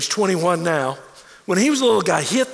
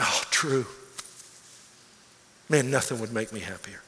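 soft, low, tender answer; tender moved reply; 7 semitones lower, 7 dB quieter than usual. Retold or imitated. Father hugging his son, answering tenderly and softly. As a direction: speak it gently, holding back tears.

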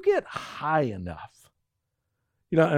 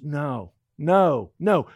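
slow, forceful and louder; Paul insisting; 2 semitones higher, 4 dB louder, slower than usual. Retold or imitated. Voicing Paul to the congregation, slow and forceful. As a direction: thunder it like an apostle. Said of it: "Paul goes".

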